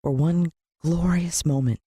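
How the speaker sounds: plain reading voice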